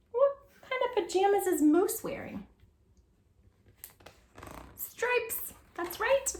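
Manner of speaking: high voice. Shown as a demonstration. Asking children a question about the picture.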